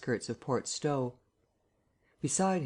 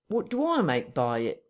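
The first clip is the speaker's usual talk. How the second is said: higher, wounded complaint; aggrieved; 3 semitones higher than usual. Acted. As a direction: sound terribly wronged. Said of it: "in a tone of unendurable wrong".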